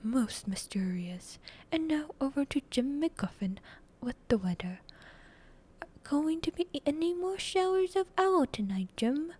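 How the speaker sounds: loud anchor handing over to the weather; punchy on-air banter; TV newscaster. Acted. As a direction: project it with a grin, like a news presenter.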